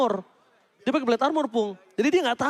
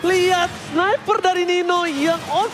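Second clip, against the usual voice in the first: fighting game announcer; 6 semitones higher, 3 dB louder, slower than usual. Acted. Hype call continues.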